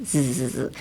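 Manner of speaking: a soft buzzing Z sound. Shown as a demonstration. Teacher demonstrates the Z sound. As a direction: buzz the Z on your teeth. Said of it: "Zのサウンドです。".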